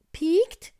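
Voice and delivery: very high, loud, rising question; little bear exclaiming. Acted. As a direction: squeal it back as an indignant question. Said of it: "rief der kleine Bär". (schrill hoch gerufene Bärchenfrage)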